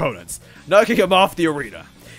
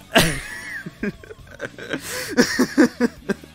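long, high laughing fit; real helpless laughter. Real, not acted. Reader loses it over the line, helpless laughter. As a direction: laugh till the breath runs out.

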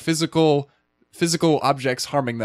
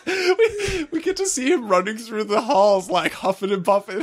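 high, giggly retelling; amused, high-pitched and breaking into laughs; laughing while talking; 9 semitones higher than usual. Real, not acted. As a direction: squeeze the words out through laughter. Laughing at old Han running.

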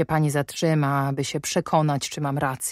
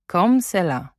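a hint of the girl's voice; 3 semitones higher than usual. Guardian recalling the mother's words to the governess, lightly mimicked. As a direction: quote the child's "comme cela" lightly. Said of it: "Dokładnie tak brzmiała odpowiedź".

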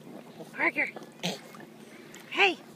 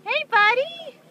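higher and louder, sing-song calling; 13 semitones higher, 8 dB louder than usual. Real, not acted. Calling baby's attention.

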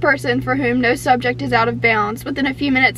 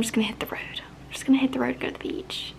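soft breathy whisper, a bit fast; 10 dB quieter, faster than usual. Real, not acted. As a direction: whisper it like a secret plan. Whispers her escape plan.

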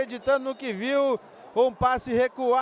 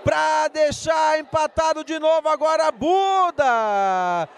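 stretched-out goal shout; goal call; 4 semitones higher, 5 dB louder than usual. Real, not acted.

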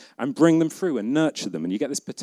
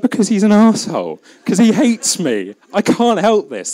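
exasperated; 10 semitones higher, 6 dB louder than usual. Retold or imitated. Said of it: "And it's just like".

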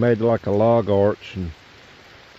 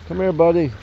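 higher, louder call to a duck; 7 semitones higher, 4 dB louder than usual. Real, not acted. Man calling his new duck over for feeding, coaxing.

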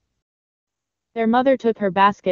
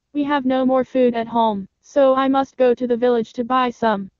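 lightly voiced mother goat, higher pitch; a careful mother's higher voice, warning; concerned mother goat; 4 semitones higher than usual. Mother goat warns her children about the wolf, concerned higher voice.

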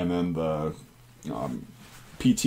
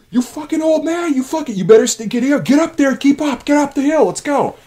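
much higher, louder screaming abuse; yelling drill sergeant; 4 dB louder than usual. Retold or imitated. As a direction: scream at the old recruit to keep up. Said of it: "they yelled out his name".

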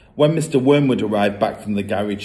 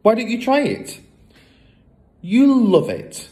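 put-on higher, earnest girlish voice; little girl pleading; 5 semitones higher than usual. Matilda defends her book to her father, high and earnest. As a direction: sweetly insist the book is lovely. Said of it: "Matilda urges her father".

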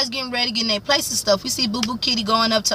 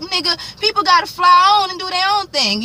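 a hint of Lucius, pitched up and pressing; Lucius pushing back; 8 semitones higher than usual. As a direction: toss it at him like an exasperated boss. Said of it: "He like".

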